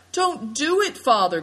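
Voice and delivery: fearful cry. A narrator's reading. Daughter cries out warning her father. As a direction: beg him to stop, alarmed. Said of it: "cried Seven Stars".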